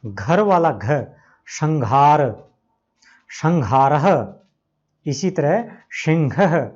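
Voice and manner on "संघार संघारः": संघारः said with the ह as घ, sam-ghaarah; slow demo: संघारः, ha turned into gha; ha after anusvara as gha. Shown as a demonstration. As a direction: slowly say संघारः with a gha sound. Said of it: "उसका उच्चारण घ की तरह होता है".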